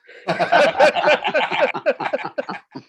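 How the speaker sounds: high, loud burst of laughter. Real, not acted. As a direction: crack up at your own joke. Laughing at own joke.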